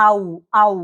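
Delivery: আউ with lips rounded. Shown as a demonstration. Teacher shows learners the German diphthong, rounded-lip আউ. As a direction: glide আউ through rounded lips, set apart. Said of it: "ঠোঁট গোল করে".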